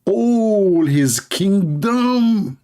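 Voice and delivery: slow, high, drawn-out mock awe; mock grandeur. Ridiculing the story, high and very slow. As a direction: stretch it out in mock amazement.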